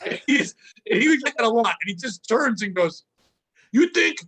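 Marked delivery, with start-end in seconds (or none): 3.7-4.2 high, indignant retort; mock outburst at a heckler; breaking character. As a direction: imitate a comic snapping out of his act. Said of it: "he broke character".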